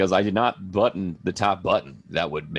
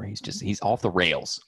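normal talking voice

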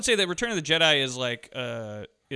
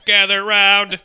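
high, much louder hammed-up call; big loud call; 6 semitones higher, 14 dB louder, much slower than usual. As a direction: boom it out to the children. Toy bear voice repeated bigger.

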